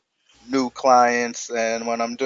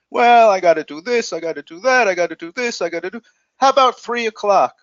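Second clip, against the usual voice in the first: higher, listing chores in a hurried ramble; rambling customer; 7 semitones higher, 3 dB louder than usual. Retold or imitated. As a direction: mimic a busy caller listing errands. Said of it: "the person will say".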